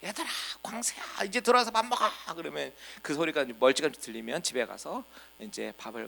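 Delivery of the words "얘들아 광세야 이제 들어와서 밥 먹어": put-on high voice calling the children home; mom calling kids. Mother calls the children in from play for dinner, high calling voice. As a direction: call out like a mother at dusk, a bit higher. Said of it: "엄마가 부르는 거죠".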